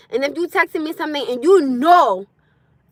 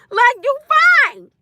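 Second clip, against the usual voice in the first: higher, slow, laughing through You fine; laughing drawn-out you fine; 10 semitones higher, 5 dB louder, much slower than usual. Real, not acted. Laughing at a panicking partner, slow amused you fine.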